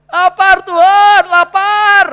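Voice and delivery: hammed-up hungry yelling; acted shout. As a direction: cry out your hunger as a mock plea. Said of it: "kemudian kita teriak".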